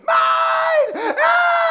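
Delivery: high, loud shriek of possession; toddler scream. Acted. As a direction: scream like a toddler guarding a toy. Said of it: "they're gonna scream".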